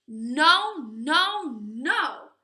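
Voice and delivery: firm, loud no no no; loud refusal. Acted. Little wolves refusing the pig at the door, loudly. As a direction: shout the refusal through the door.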